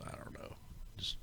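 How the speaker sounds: breathy whispered "I don't know" to himself; whispered shrug; whispered aside. Real, not acted. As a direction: drop to a whisper, thinking aloud. Muttered aside after speculation.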